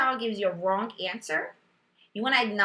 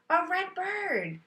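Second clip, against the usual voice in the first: put-on child's answer; 9 semitones higher, slower than usual. Teacher voicing child's answer.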